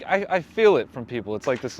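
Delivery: normal talking voice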